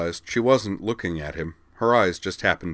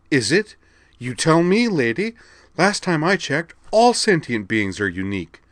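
higher, louder indignant protest; indignant retort; 10 semitones higher, 7 dB louder than usual. A narrator's reading. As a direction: fight back hard. Mia retorts to remembered counselor.

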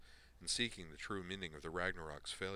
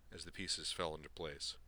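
plain reading voice

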